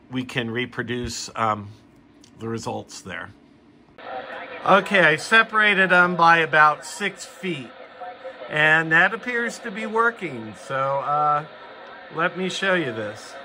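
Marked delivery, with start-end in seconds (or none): none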